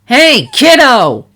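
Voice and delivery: high, loud, drawn-out call of hey kiddo; bright loud call to a kid; energetic greeting. A narrator's reading. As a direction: hail the filly like an old friend. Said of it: "She waved a foreleg energetically".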